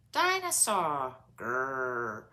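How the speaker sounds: put-on slow little-boy "dinosaur" then a low growl; small boy proudly growling; toddler growl. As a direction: play a toddler roaring at his toy. Toddler George says his word.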